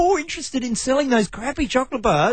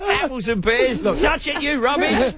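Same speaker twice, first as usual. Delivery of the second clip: mock Cockney. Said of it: "that accent will miraculously turn".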